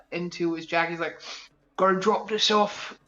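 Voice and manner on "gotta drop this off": lightly voiced businesslike rogue; a hint of a chummy crook; roguish mentor. Mentor briefs mentee on errands, in a roguish voice.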